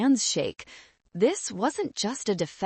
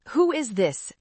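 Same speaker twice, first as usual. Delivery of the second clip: higher, sharp demand; irritated, raised-pitch challenge; snapped; 5 semitones higher than usual. A narrator's reading. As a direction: snap the question impatiently. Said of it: "Jessica snapped".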